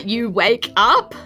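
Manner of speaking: ordinary talk, nothing marked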